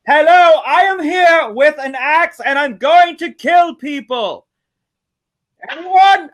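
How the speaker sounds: loud, high-pitched villain proclamation; movie villain. Acted. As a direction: announce it slowly and loudly like a hammed-up villain. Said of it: "So the villain goes".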